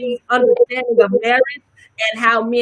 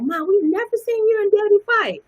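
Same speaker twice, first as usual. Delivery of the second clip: higher voice, imitating grown kids; kids talking to mom; 11 semitones higher than usual. Grown kids telling their mom, imitated higher.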